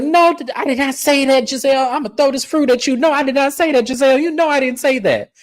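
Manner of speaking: high, frantic mock denial; panicked denial. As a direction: squeal the denial way up, flustered. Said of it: "And Kay was like".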